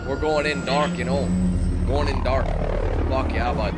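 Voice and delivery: loud Canadian hoser commando; hammed-up Canadian accent. Canadian special forces guy psyching up a buddy, thick Canadian accent. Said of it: "like a Canadian special".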